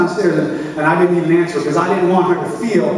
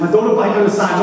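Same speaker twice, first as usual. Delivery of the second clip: usual speaking voice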